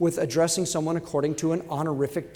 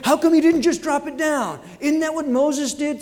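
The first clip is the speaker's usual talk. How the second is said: mock indignant; 8 semitones higher, 5 dB louder than usual. Mock indignant voice after a fall.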